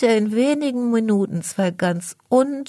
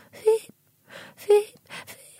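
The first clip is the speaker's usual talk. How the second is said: tiny squeaks, very high and thin; high squeaky peeps; slower than usual. Acted. Narrator voicing a trapped beetle's cries: high squeaky peeps. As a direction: peep like a trapped little beetle. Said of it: "ein leises Fiepsen hört".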